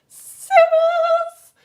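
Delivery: very high, loud, held cirrus; pop star squeal. Shown as a demonstration. Teacher recapping the very high clouds, high pop star squeal. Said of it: "very as high pitched as you can".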